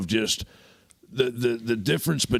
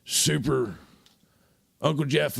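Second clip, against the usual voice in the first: ordinary talk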